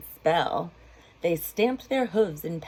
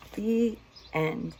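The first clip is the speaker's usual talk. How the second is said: ordinary delivery, nothing marked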